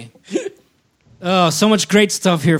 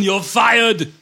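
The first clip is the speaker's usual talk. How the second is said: yelled movie line, higher; shouted movie quote; 7 semitones higher than usual. Retold or imitated. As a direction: bark it like an action hero. Quoting movie line again, shouted.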